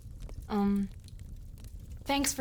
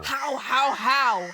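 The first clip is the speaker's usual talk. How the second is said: mocking parrot of Hook's question, rising louder; mocking echo; 5 dB louder, slower than usual. Peter mocks Hook's constant question, teasing and exasperated. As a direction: throw his own word back at him, teasing. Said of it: "That's all you ever say".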